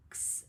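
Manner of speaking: "X" said as a soft ks sound. Shown as a demonstration. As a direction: whisper the "X" as ks. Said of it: "And then that x sound".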